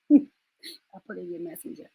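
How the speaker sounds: normal talking voice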